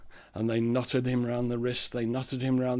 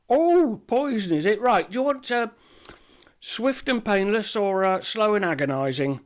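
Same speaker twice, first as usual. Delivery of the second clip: much higher, louder, eager shopkeeper; chirpy shopkeeper; 9 semitones higher, 6 dB louder than usual. Acted. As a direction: greet him like a fussy shopkeeper. Apothecary offers poisons.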